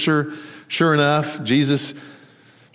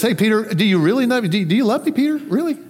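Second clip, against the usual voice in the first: higher, doubting, repeated question; insistent second asking; 6 semitones higher, faster than usual. Retold or imitated. Jesus asking Peter a second time, mildly acted insistence. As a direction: press the question, pitch lifted. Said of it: "Jesus then on the second time says".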